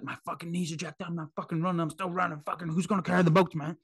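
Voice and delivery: high, hammed-up American accent; Goggins accent. Podcaster imitating Goggins refusing to stop running, mock American accent. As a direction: imitate a hyped American runner's accent, pitched well up. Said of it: "I don't know why I'm trying his accent".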